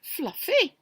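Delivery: high, small voice; a little creature answering shyly; tiny high reply; small porcupine. Acted.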